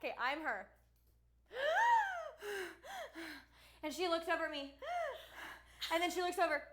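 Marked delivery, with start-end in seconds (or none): 1.5-3.4 sharp high gasp, acting out waking in shock; startled gasp. Friend jolting awake in fright, sharp gasp. Retold wake-up scare. 4.8-5.6 startled gasp. Retold or imitated. Retold wake-up scare.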